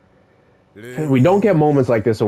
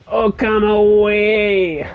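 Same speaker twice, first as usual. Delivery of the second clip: slow, higher held-out catchphrase; drawn-out character cry; 5 semitones higher, much slower than usual. Retold or imitated. Gamer echoing the anime character's catchphrase, drawn-out imitation. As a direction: stretch the last word out long and high.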